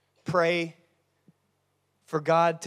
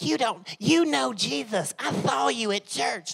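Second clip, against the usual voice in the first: put-on little girl voice; 7 semitones higher than usual. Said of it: "a little girl's like".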